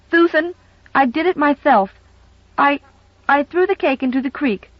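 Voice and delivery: put-on high, fretful child confessing; a small girl blurting out her guilt; anxious little girl. Little girl confessing to housekeeper, anxious and high.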